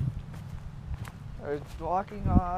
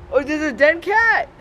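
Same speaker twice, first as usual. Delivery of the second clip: shocked; 8 semitones higher, 6 dB louder than usual. Real, not acted. Finds a dead cat.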